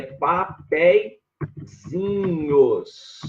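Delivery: "papeizinhos" broken into long, separate syllables. Shown as a demonstration. Teacher revealing the tricky plural to the class, slow syllable by syllable. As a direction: stretch "papeizinhos" out slowly, pausing between its parts. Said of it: "Olha essa, gente, ó".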